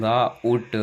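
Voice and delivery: "ग, उट" as a long गा, then उट; split pronunciation. Shown as a demonstration. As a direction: sound out "ग, उट" in two parts. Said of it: "आओ निकल रहा है साउंड". ("ग, उट" को दो हिस्सों में खींचकर)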